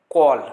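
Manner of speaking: "call" said kol, long o, loud. Shown as a demonstration. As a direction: stretch the o in "call". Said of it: "देखिए यहाँ पे ओ ओ लग रहा है". ("call" को ओ की आवाज़ से बोलें)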